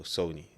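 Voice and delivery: "Sony" as SOH-nee; first syllable of "Sony" like "so"; corrected pronunciation. Shown as a demonstration. Settling on the brand name for viewers, "Sony" said SOH-nee. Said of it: "I heard it's called Sony".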